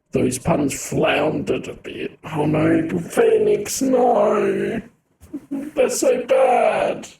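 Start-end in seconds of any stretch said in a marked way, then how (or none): 2.2-7.1 groaning. Real, not acted. Groaning at bad puns.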